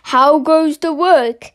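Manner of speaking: lightly voiced lazy farmer calling out; a hint of the farmer's voice. A narrator's reading. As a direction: call it out like a lazy farmer from bed. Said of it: "he called".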